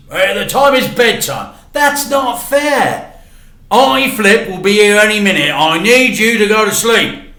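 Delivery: a dad hurrying his son off to sleep; evasive dad. Acted. Dad dodges the question.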